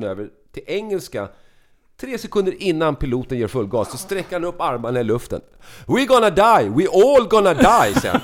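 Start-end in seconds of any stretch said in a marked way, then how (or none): 5.9-8.0 loud, high, hammed-up doom cry; panicked screaming. Drunk flyer with arms up before take-off, loud wailing mock panic. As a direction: wail it to the whole cabin.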